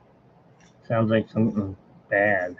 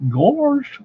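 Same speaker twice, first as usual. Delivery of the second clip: a much higher Goofy "Gosh" with a laugh; 13 semitones higher, 4 dB louder than usual. Retold or imitated. Retelling how the voice actor found Goofy, a high Goofy "Gosh". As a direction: squeak it out like Goofy. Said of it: "So I kinda went like".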